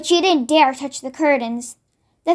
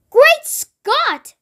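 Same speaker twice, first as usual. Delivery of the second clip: shocked cry, much higher and louder; cried out; 11 semitones higher, 4 dB louder than usual. Acted. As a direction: burst out in alarm. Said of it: "cried the famous voice".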